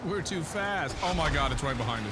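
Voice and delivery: high, loud alarmed voice; panicking, it's right behind us; being chased. Real, not acted. Monster right behind them.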